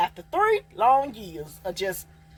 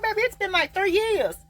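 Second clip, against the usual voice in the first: exasperated; 12 semitones higher than usual. Retold or imitated. Exasperated reply to ex.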